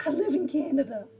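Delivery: hammed-up Scottish insistence; mock Scottish accent. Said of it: "like, Scottish".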